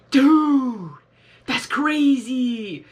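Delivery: loud, drawn-out amazement; amazed exclamation. Real, not acted. Reactor discovering a matching phone, amazed. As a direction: stretch it out loudly, blown away.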